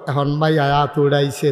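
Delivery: ordinary talk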